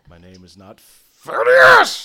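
loud, slow, offended yell; indignant shout. Acted. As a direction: bellow the correction. Paladin corrects his name.

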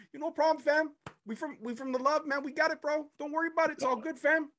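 a Scarborough guy brushing off thanks, Toronto slang. Pretend. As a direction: reassure the man in a high Scarborough voice. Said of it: "talking to Scarborough".

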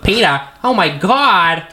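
high, slow sing-song cartoon mom voice; Lois voice. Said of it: "I was like".

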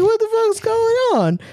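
very high-pitched, hammed-up alarm; squeaky-high mock disbelief; mock shocked outburst. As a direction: play a mom bursting in, exaggerated. Acting out mom walking in shocked.

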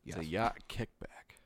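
whispering. Pretend. Joke suggestion in group banter.

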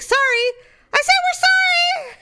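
put-on high, loud drunken shout back; mock drunken yell, very high; drunk apology yelled. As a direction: holler it like the drunkest one there. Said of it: "the drunkest of all of us is like".

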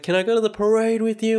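put-on high, quick little-kid plea; a small boy begging to tag along; pleading kid. Son asks dad to the parade, high kid voice.

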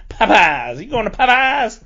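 Popeyes punched loud as "PAH-pahz"; loud, rising local-style Popeyes; local Southern pronunciation. Shown as a demonstration. Showing off how locals ask about Popeyes, playful local pronunciation. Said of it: "and we say Popeyes".

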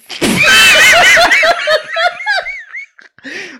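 high shrieking laugh; loud laughing fit. Real, not acted. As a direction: burst out into big laughter. Cohost bursts out laughing.

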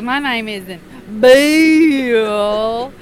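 very slow, loud drawn-out protest; exasperated. Retold or imitated. Engineer protesting his name to Sheila, slow and loud. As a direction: drag it out loud in frustration. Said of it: "He goes".

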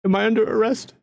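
lightly high nervous noble; frightened noble. Acted. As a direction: ask in a high, timid voice. GM voices the noble.

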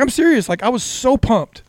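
high-pitched retold protest; exasperated insistence. Husband insists to laughing wife he was pumped, high exasperated protest. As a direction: plead your case, all worked up.

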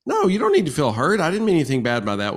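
surprised protest. Retold or imitated. Wife waving away husband's hurt, high startled protest. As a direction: jump high and loud on no, then rush the reassurance. Wife's dismissive reply, retold.

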